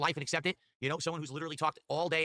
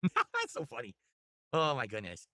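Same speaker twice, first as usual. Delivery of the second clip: laughing; slower than usual. Real, not acted. Laughing after the joke.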